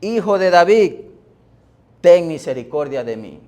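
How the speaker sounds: loud acted plea, starting high; insistent crying out. Blind man clamouring over those hushing him, insistent crying out. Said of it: "pero él clamaba mucho más".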